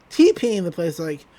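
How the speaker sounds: ordinary talk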